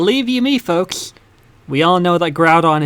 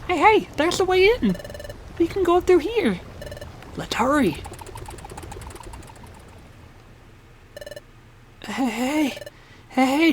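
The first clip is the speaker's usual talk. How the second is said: high urgent hero voice; 6 semitones higher, 4 dB quieter than usual. Acted.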